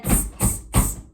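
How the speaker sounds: cheeky monkeys hissing back; monkey tsk. A narrator's reading. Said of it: "the monkeys ... and said".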